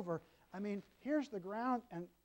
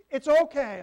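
loud, higher "it's okay"; loud reassurance; 6 semitones higher, 14 dB louder than usual. Retold or imitated. Grandpa cutting off the mother's worry, loud. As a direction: call it out to reassure.

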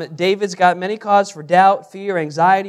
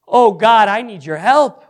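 loud, raised plea to God; an acted cry to heaven, higher and louder; 4 semitones higher, 8 dB louder than usual. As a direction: call out for help in urgent prayer. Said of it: "but it's audible. He is out loud".

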